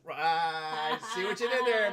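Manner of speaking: booming, drawn-out mock appreciation; playful exaggerated holler; hammed-up loud reaction. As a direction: belt it out slowly, high and very loud. Hammed reaction to listener's pun.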